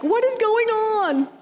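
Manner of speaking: very high, stretched-out alarmed squeal; hammed-up alarm, voice shooting way up; mock panic. Rattled yoga student reacting to a heckler, mock panic.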